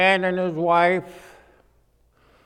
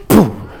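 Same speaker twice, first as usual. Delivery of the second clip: loud boom; 5 semitones lower, 8 dB louder than usual. Retold or imitated. Girl lands in seat.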